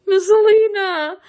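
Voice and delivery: high loud squeal of delight; excited squeal. Real, not acted. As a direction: squeal the name like a fan. Fangirl reaction to couple.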